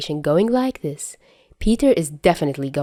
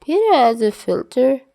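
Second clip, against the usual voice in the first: giddy insistence; 7 semitones higher, 4 dB louder than usual. Acted. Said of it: "Peter starts".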